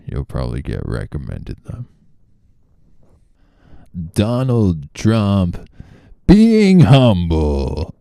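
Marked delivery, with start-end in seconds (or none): 4.1-7.9 higher, slow mock Trump drawl; naming the bit in a hammed-up Trump voice; Trump impression. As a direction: stretch it out as a parody ad voice. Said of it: "That's a great bit".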